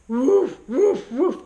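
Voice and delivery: loud, round dog woofs; Great Dane impression. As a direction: bark like a big dog, playfully faked. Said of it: "my dog impression of a Great Dane".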